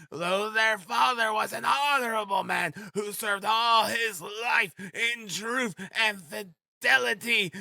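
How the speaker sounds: very high-pitched, drawn-out ranting; shouting. A narrator's reading. Frantic widow refusing to go home, shouting. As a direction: wail it out in a high, overwrought shout. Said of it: "she shouted, speaking fast, panting and coughing".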